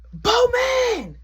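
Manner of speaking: high, loud yell of a name; shout. Retold or imitated. Grandson yelling for his brother, a high loud shout. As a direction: yell it across the house.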